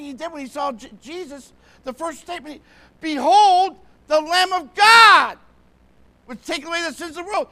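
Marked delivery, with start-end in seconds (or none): 3.0-7.5 high, very loud shout of revelation; excited proclamation. Acted. John the Baptist seeing Jesus at the Jordan, excited shout. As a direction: cry it out like a thrilled herald. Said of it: "He was excited".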